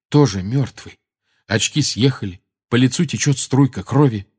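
plain reading voice

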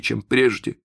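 ordinary delivery

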